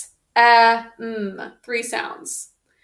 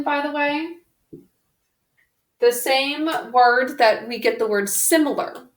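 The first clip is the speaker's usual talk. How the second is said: usual speaking voice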